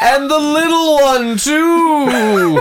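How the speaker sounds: announcer voice. Pretend. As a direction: bellow the names like a big announcer. Announcing names in big voice.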